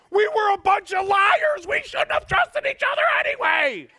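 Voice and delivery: high, loud comic shout; preacher shouting about lying buddies, loud and high. Real, not acted. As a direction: yell it out over the crowd.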